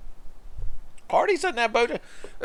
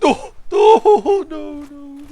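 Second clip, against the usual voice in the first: very high mock wail that fades out; fading away wail; 8 dB louder, much slower than usual. Player imitating his ally vanishing, fading away wail. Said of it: "That was him fading away".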